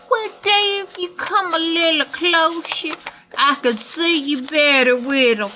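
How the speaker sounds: a wolf faking a granny voice; wolf hamming it up as grandma; wolf playing grandma. A narrator's reading.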